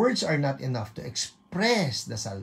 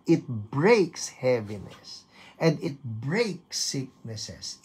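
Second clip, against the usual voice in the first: ordinary delivery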